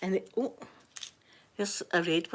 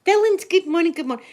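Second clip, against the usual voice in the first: excited greeting; 10 semitones higher, 9 dB louder, faster than usual. Real, not acted. Greeting a viewer on livestream.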